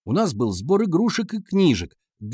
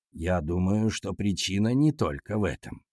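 lightly voiced teacher, lower pitch; calm male teacher; 5 semitones lower, 4 dB quieter than usual. A narrator's reading. Class teacher answering the class, low measured voice. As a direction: weigh it calmly, pitched down.

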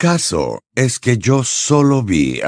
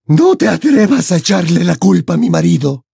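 high, menacing female character voice; sinister threat in a raised pitch; malevolent warning; 9 semitones higher, 5 dB louder than usual. A narrator's reading. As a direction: warn with venom, high and forceful. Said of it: "con voz queda y maléfica".

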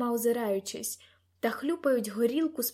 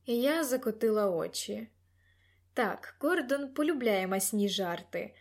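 ordinary delivery, nothing marked

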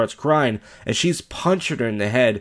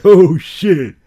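much louder, higher delighted outburst; gleeful exclaim; 7 semitones higher, 11 dB louder than usual. Retold or imitated. Imitating the cameraman enjoying the fight, loud gleeful blurt. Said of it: "He's like".

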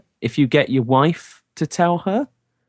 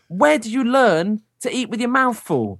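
pitched-up, pointed telling-off; mock parental scolding; 9 semitones higher than usual. Dad scolding child to hint at mother-in-law, staged indignation. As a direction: scold the child for someone else to hear. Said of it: "Daddy's gonna shout at you".

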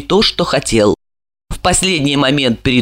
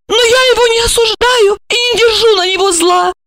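high hot-tempered female voice; heated; 12 semitones higher than usual. A narrator's reading. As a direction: defend yourself with fervour. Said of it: "горячась, воскликнула".

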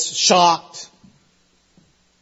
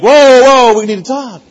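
mock alarm; 3 semitones higher, 6 dB louder than usual. Stopping a friend blurting secret.

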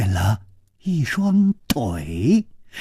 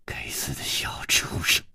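whispered curse full of hate; hissed curse; 10 dB quieter than usual. Acted. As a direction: hiss the curse under your breath. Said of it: "whispered curse".

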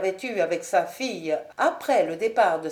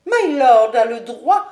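Lady Ashton; 3 semitones higher, 9 dB louder than usual. Acted. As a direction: lash back at the marquis.

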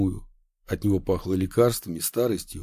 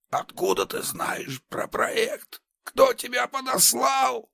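furious shout; slower than usual. Acted. Madman grabs major's sleeve.

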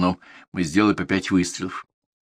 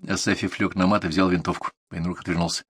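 ordinary delivery, nothing marked

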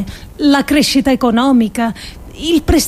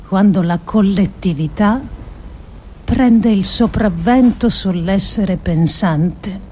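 ordinary talk

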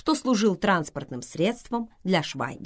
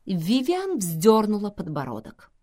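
ordinary delivery, nothing marked

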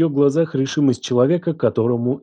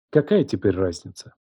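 plain reading voice

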